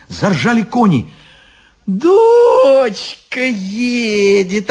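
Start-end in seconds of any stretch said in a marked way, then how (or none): 1.8-4.7 high, drawn-out joyful cry; overjoyed. A narrator's reading. Baba Yaga sees her daughter coming, overjoyed. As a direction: call out the news with delight. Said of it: "обрадовалась Баба Яга".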